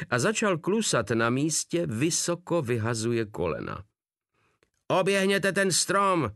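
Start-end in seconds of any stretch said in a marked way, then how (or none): 4.9-6.3 howled order. A narrator's reading. Captain howling orders at the running soldier. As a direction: howl it at him like a furious officer. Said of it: "zavil von Páder".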